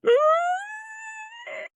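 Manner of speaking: drawn-out high squeal of shock; imitated shriek, held high; long high shriek. Both haircutters realize they were understood, long shocked squeal. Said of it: "We both went".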